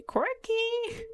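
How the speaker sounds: very high, drawn-out squeal; mock quirky. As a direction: say it in a squeaky, exaggerated mocking pitch. Mocks a word she hates.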